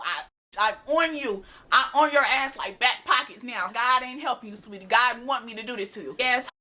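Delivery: normal talking voice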